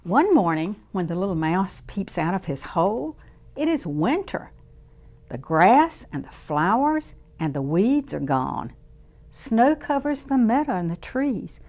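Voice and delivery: lively storybook voice for little ones; reading to children. A narrator's reading. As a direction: read it warmly to a circle of kids. Reads picture book to children.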